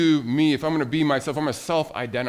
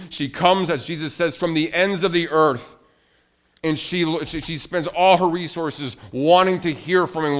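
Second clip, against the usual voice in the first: higher, louder, emphatic sermon delivery; impassioned preaching; 5 semitones higher, 8 dB louder than usual. Acted. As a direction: preach it with mounting force on key words. Preaches the Queen of Sheba story.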